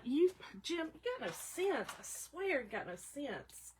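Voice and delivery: mock scolding of a husband who won't give blood; exasperated scolding. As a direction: shake your head at him, quietly. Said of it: "I'm like".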